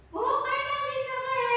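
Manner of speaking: put-on high, loud, slow child's complaint; whiny pupil. Pupil telling the teacher someone cried, high and whiny. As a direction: whine up at the teacher like a small kid.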